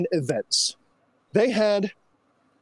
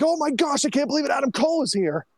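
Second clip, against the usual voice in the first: higher, fast gushing as an imitated fan; excited fan; 7 semitones higher, faster than usual. Re-enacting fans at Adam Cole's debut, pumped-up excitement. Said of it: "so pumped up".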